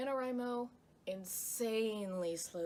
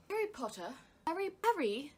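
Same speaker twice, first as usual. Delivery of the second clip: higher, hammed-up British schoolgirl; Hermione Granger; 7 semitones higher, 7 dB louder than usual. Shown as a demonstration. Vlogger retrying a film impression, high British character voice. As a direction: call out high and loud in British accent. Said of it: "That wasn't actually a very good Hermione".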